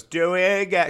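put-on high, loud drunken voice; mock drunken demand; hammered heckler. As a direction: imitate a drunk woman calling out. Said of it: "this girl's fucking hammered".

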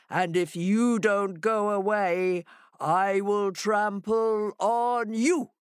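slow, stretched-out monster threat; booming monster voice. Acted. Monster inside the house threatens Hare, booming slowly. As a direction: draw each word out like a huge menacing creature. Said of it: "the voice replied".

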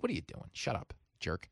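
soft, under-the-breath telling-off; low-volume grumble at oneself; muttered self-scolding. Acted. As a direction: scold yourself quietly, mildly annoyed. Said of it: "I get so mad at myself".

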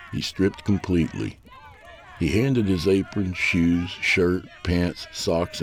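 plain reading voice

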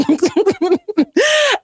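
high fake crying wail; mock wail. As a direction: wail like a sulking child. Mock crying sound in Ella quote.

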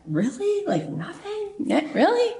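high, rising, disbelieving squeak; incredulous disbelief. Retold or imitated. Oprah stunned the studio hasn't called, squeaking high disbelief. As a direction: voice jumps way up in disbelief.

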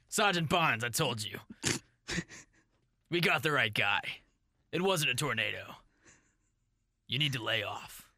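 lightly voiced stern police captain; half-hearted gruff boss warning his sergeant. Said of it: "I'm the captain".

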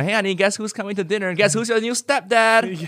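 high sing-song mother; mom voice. Retold or imitated. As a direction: sweetly break news to son. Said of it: "the mom's telling, telling the son".

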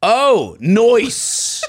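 hammed-up goofy texter; mock-cool drawn-out delight; wannabe-cool dad. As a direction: stretch the slang as a try-hard cool dad. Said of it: "Okay, here's him".

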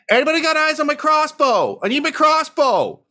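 high-pitched frantic holler; high, urgent character shout; calling out. Acted. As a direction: holler across a battlefield for help. Game master voices character calling for crossbow.

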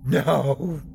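high, anguished wail; sobbing. A narrator's reading. Armed man refusing to talk, sobbing. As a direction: wail it as if in tears. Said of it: "He was sobbing now".

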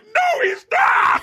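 high shrieking outcry; outraged fans. Retold or imitated. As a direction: shriek it in protest. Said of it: "They're like".

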